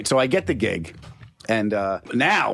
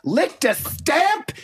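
"licked a stamp" stretched very slow and high; milk "licked a stamp" for all it's worth; drawn-out emphasis; much slower than usual. Retold or imitated. Son mimicking mother marveling at each step, very slow. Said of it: "and she's loud".

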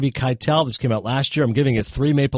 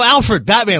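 exasperated; 10 semitones higher, 6 dB louder, slower than usual. Retold or imitated. Exasperated, prompting makeup artist.

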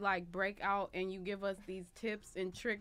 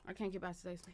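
much softer, murmured repeat; quiet; 6 semitones lower, 13 dB quieter than usual. Real, not acted. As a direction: say it again under the breath. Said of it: "repeated quieter".

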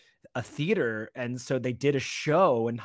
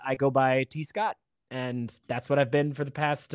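ordinary talk, nothing marked

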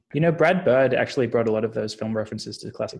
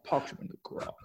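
soft, husky impression with a put-on accent; half-voiced demo of a famous actor's accent; Sean Connery; 6 semitones higher, 10 dB quieter than usual. Shown as a demonstration. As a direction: murmur it as a movie-star impression. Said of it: "just that low monotone".

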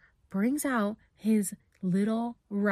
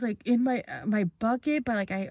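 ordinary talk

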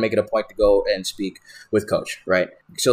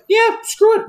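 a sudden squeaky high voice for the lazy staff; mock careless staff; 3 dB louder than usual. Staff dumping the stools in the doorways, squeaky high mock voice. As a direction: squeak it out like a staff member who doesn't care. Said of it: "They just decided".

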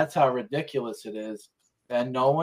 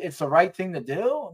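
ordinary talk

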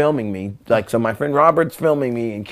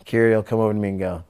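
ordinary delivery

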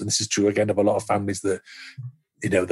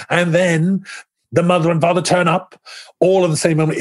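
higher-pitched, eager retelling of a pitch; raised, keyed-up pitch to a room; excited pitch; 7 semitones higher than usual. Retold or imitated. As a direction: build up the big plot moment with excitement. Acts out pitching the show.

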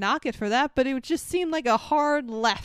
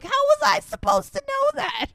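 whiny Sam; 3 dB louder than usual. Retold or imitated. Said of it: "he's like".

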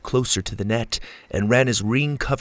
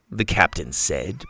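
usual speaking voice

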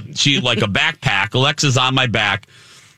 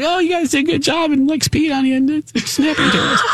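high, fast offhand put-on voice; lightly voiced zookeeper slipping it in; casual mock zoo guy; 12 semitones higher, faster than usual. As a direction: breeze through it carelessly. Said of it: "he's like".

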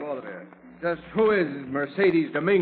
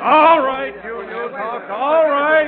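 shouting over a crowd; 9 semitones higher, 11 dB louder, much slower than usual. Acted. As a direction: raise voice over reporters. Calling over noisy reporters.

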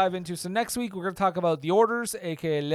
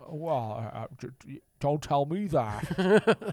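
put-on faltering, lower old movie star; Jimmy Stewart; 3 semitones lower, slower than usual. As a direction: stammer slowly like a wounded old actor. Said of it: "Thanks, Jimmy".

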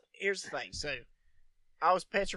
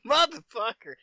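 higher, louder startled curse; mock outraged yell; 9 semitones higher, 12 dB louder than usual. Man retelling finding the snake under him, loud outraged curse. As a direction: blurt it out, outraged. Retold reaction to snake in recliner.

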